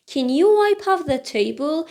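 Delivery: ordinary talk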